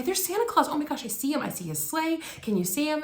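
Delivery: high, eager mom pointing at the sky; excited mom. Retold or imitated. Mom showing Keisha Santa in the sky, high and eager. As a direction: gush like she really sees him. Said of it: "she's like".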